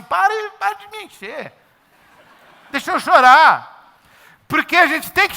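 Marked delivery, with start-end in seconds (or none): 0.0-4.0 mock-exasperated, much higher; exasperated retort. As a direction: wave them off in a high, fed-up voice. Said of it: "você fala assim".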